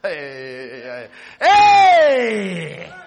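loud call to the crowd. Real, not acted. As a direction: call out to grab the room. Preacher calling out to crowd.

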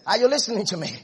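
ordinary talk

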